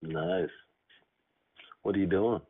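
ordinary delivery

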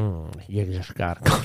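low, soft attempt at a famous voice; Morgan Freeman. Co-host trying the suggested actor's voice, low failed impression. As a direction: drop it way down, a half-hearted celebrity impression. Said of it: "I can't do Morgan Freeman".

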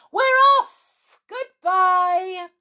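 very high, loud jubilant cry; drawn-out, delighted holler; triumphant yell. A narrator's reading. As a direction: crow a gleeful farewell. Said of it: "gave a yell of triumphant delight".

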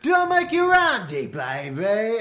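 loud, high catchphrase impression; Austin Powers. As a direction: shout it as a hammed-up, high-pitched catchphrase. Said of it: "I was Austin Powers".

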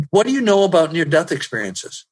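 ordinary talk, nothing marked

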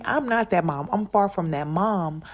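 normal talking voice

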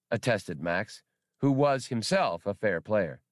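ordinary delivery

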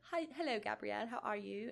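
a hint of a British man's accent. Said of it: "a British guy".

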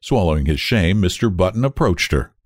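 ordinary delivery

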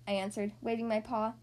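plain reading voice

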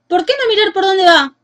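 high, loud angry yell; indignant shout. A narrator's reading. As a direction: yell at the careless witch. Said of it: "gritó el hombre indignado".

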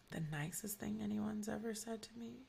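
whispered question to herself; soft whispered musing. Real, not acted. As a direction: whisper it while thinking.

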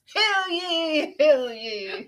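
high ringing town crier call. Pretend. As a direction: cry it out to the square. Mock proclamation, hear ye.

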